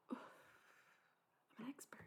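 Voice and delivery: whispered, slow and smug; mock whisper. Streamer boasting to chat in a mock whisper. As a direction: breathe it as a secret boast.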